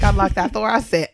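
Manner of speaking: ordinary delivery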